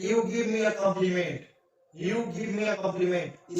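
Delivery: very slow, word by word; slow teacher dictation of "You give me a compliment". Shown as a demonstration. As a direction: spell the sentence out for students. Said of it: "teacher dictates example sentence".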